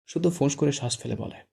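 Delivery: plain reading voice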